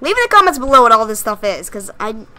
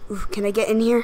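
normal talking voice

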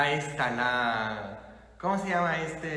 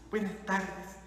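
soft, unenthusiastic mumbled greeting; imitating a half-hearted congregation; listless greeting; 8 dB quieter than usual. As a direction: greet back without any will. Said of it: "sin ganas, no? Como".

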